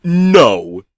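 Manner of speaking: loud sharp no; cheery shout. Acted. Pizza man shouting at the narrator, loud. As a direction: cut him off with a shout. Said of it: "shouted back at me".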